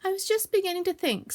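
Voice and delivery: high, woeful voice; high-pitched mild self-pity; sniffing slightly. Acted. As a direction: fret as if a little tearful. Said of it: "said Bear, sniffing slightly".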